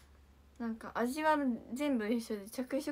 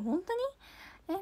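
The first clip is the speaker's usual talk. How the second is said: surprised; 7 semitones higher, much slower than usual. Real, not acted. Hearing a fan likes her.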